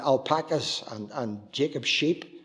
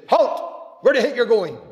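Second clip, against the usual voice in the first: sharp, loud, much higher sentry shout; soldier's challenge; 9 semitones higher, 9 dB louder than usual. Retold or imitated. Sentry halting children at the gate, shouting loud. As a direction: bark it out like a Roman sentry.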